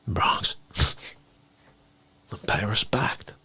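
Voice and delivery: low, soft, spare delivery; quietly acted phone demand; terse caller. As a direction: drop your voice and leave long gaps. Caller's terse demand voiced in story.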